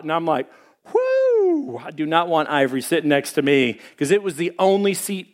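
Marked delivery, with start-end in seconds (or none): 0.9-1.8 high drawn-out whoo of dread; mock high whoo. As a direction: let out a long, high "whoo". Reacting to no room on bus.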